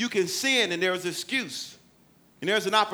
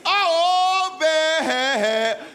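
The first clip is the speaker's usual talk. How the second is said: high, loud mock wail to a god; over-the-top desperate calling; hammed-up crying out; 9 semitones higher, 6 dB louder, much slower than usual. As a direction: holler the name, stretched out and breaking into pieces. Prophets crying louder to Baal.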